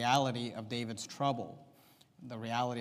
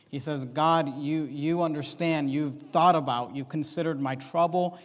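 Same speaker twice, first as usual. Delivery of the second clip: louder, raised preaching voice with punched key words; emphatic preaching; 3 semitones higher, 6 dB louder than usual. Real, not acted. Preacher driving home David's trust to a congregation, louder emphatic preaching. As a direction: preach the verses out with mounting conviction. Preaching builds over the psalm verses.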